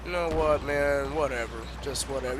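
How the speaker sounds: defeated mutter. Real, not acted. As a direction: let it go with a sigh of defeat. Giving up after failure.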